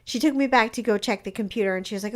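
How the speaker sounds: usual speaking voice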